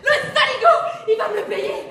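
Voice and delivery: loud furious outburst; furious shout. Acted. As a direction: yell it out, outraged. Furieuse contre le maïs. (crier de rage)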